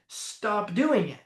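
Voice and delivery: usual speaking voice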